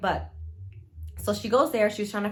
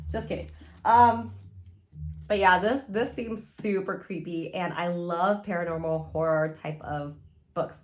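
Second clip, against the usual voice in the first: ordinary talk, nothing marked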